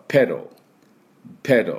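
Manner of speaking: 「ペダル」を「ペロー」のように; 「ペダル」のAをほとんど出さず「ペロー」; 英語発音. Shown as a demonstration. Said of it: "っていうような音になります". (手本として「ペダル」を英語風に「ペロー」)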